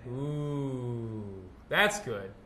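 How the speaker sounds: normal talking voice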